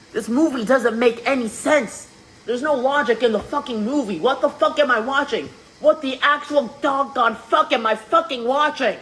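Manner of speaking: worked-up, high-pitched outrage; exasperated rant. Real, not acted. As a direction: rant in disbelief, pitch climbing to the end. Exasperated rant about the movie.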